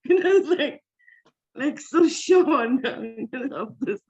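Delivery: amused, high-pitched laugh through the words. Real, not acted. Woman recalling how sure she was, laughing as she talks. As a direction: let laughter break into the telling.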